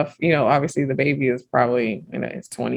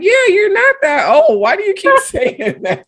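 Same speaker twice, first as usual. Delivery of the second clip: amused friend; 5 dB louder than usual. Retold or imitated. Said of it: "He was like".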